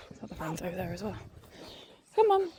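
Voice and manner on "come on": loud high call across the field; calling the dog. Real, not acted. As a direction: shout to fetch the dog back. Calling her dog across park.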